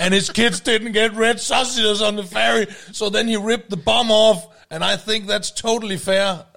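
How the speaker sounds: loud, high, slow mock English speech; mock confrontation. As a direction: deliver a pretend comeback at full volume, word by word. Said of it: "Du skulle have sagt".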